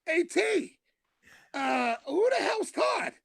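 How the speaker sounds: high, slow, shouted "who the hell's Todd?"; neighbor's high mock shout. Rick confronting the comic after the show, high mock shout. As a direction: play him indignant, voice jumped way up.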